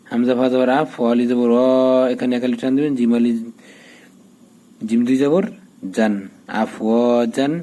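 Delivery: usual speaking voice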